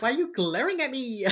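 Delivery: high, loud hammed-up whine of complaint; mock wounded protest. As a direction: protest the glare in exaggerated innocence. Acting out reaction to a glare.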